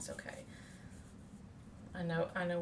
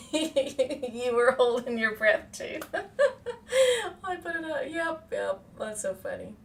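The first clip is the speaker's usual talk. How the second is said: laughing; 6 semitones higher, 7 dB louder than usual. Real, not acted. Laughing at viewer's comment.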